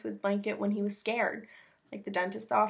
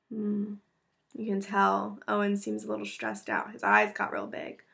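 ordinary delivery, nothing marked

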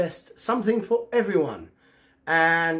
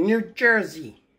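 put-on half-hearted New Jersey accent; a light, clumsy go at New Jersey accent; badly faked New Jersey accent; 2 semitones higher, slower than usual. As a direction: attempt a New Jersey accent, not very well. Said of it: "I can't do that accent very well".

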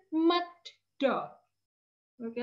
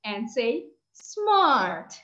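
slow, drawn-out prompt; "smart" said slowly with a fall; 3 semitones lower, much slower than usual. Shown as a demonstration. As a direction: stretch it slowly for the class. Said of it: "Can you do it like this".

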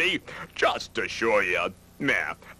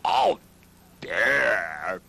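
caught-out uh-oh; 4 semitones lower, much slower than usual. Acted. Caught after eating the cake.

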